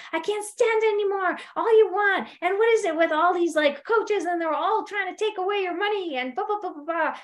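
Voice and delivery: high-pitched ranting husband; imitating an angry rant. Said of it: "kept screaming and yelling at her".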